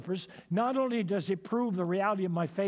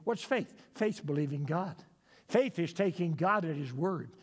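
ordinary talk

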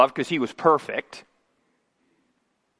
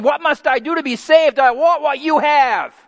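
higher, urgent plea of the jailer; jailer's plea; 8 semitones higher, 4 dB louder than usual. Retold or imitated. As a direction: beg like a man desperate to be saved. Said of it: "he's looking at this and saying".

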